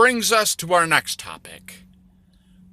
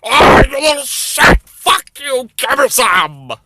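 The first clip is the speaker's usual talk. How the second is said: high, loud desperate cursing; starving man yelling at a taunting kid; angry starving man; 10 semitones higher, 7 dB louder than usual. Acted. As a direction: snarl at the kid, half crying. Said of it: "And the guy just says".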